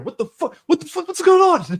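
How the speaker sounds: high, rising alarmed yelps; mock panic. Friend playing someone suddenly held from behind, mock panic. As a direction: build from startled to a high mock yell.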